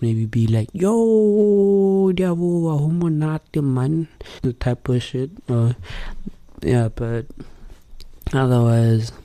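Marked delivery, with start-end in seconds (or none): 0.7-4.1 high, bright squeaky sing-song greeting; character voice. Shown as a demonstration. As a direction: pitch way up into a chirpy hype welcome. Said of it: "I'll use my character voice".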